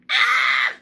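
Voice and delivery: high breathy shriek; frustrated scream. Real, not acted. Overwhelmed vlogger shrieking at viewers.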